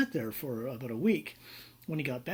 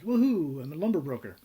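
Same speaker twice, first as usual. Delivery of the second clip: celebrating; 3 semitones higher, 6 dB louder than usual. Retold or imitated. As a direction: imitate a client's gleeful woohoo. Client phones, celebrating first deal.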